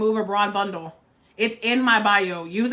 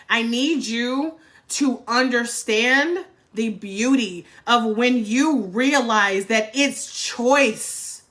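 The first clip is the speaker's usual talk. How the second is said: very slow, deliberate plea; slow heartfelt emphasis; much slower than usual. Real, not acted. Urging viewers, slow heartfelt plea. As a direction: lean on every word, slowly.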